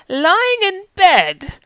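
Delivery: high, slow bad British accent; a hammy attempt at a British accent. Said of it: "I'm such a bad British accent".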